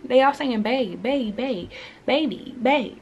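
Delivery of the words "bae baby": ordinary delivery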